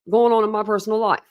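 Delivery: ordinary talk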